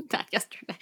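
a quick, laughing whisper; a giggly whispered joke to herself; whispered aside. Real, not acted. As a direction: breathe it fast under a laugh.

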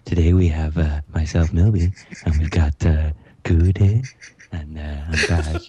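creepy radio announcer. Acted. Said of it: "that was my slightly creepy, uh, radio announcer voice".

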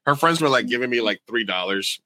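normal talking voice

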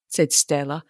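plain reading voice

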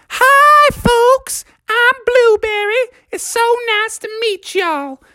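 very high, sugary Southern greeting; sweet lady bear. Acted. Blueberry introducing herself to the frogs, very high sweet voice. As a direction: greet them sweet and bright.

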